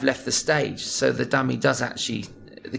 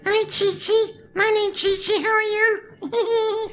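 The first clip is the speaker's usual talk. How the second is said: very high falsetto puppet voice; tiny cute dog puppet squeak; Chi Chi voice; 3 dB louder, slower than usual. Shown as a demonstration. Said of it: "And then Chi Chi".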